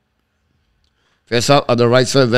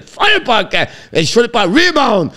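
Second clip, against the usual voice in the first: excited shot call; 10 semitones higher than usual. Real, not acted. Commentator calling McKinnon's shot and rebound, excited. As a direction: pitch climbs high and keeps rising to the rebound.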